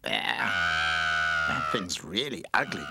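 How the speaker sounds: slow, low sneer of disgust; disgusted skateboard. Acted. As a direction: drag out the insult. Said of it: "cried Skateboard".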